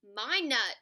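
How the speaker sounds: loud possessive cry; shouting. A narrator's reading. Squirrel claiming the nut from a rival, shouting. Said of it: "Pecan shouted".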